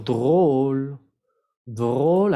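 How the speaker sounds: "drôle" with a long, closed French O. Shown as a demonstration. Teacher models it for learners, "drôle" with closed O. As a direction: round and stretch the O in "drôle". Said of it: "aquí es con una O cerrado".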